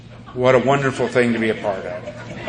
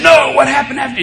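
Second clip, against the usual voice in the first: much higher, louder demanding ask; insistent question; 13 semitones higher, 8 dB louder than usual. Retold or imitated. Cops demanding to hear what came next, insistent and high. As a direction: push hard for more. Pushy questioners, good cop bad cop.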